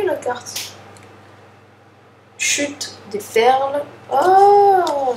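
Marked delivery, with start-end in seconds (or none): 4.1-5.1 high, loud thrilled "Oh"; excited oh. Real, not acted. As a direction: react with delight to the card. Card reveal while opening a pack.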